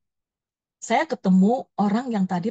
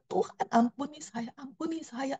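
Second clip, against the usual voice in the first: hushed, higher-pitched pleading that fades away; sorrowful plea; 6 semitones higher, 8 dB quieter than usual. Retold or imitated. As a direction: beg for mercy, voice sinking almost to nothing. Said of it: "bisa sedih gitu loh".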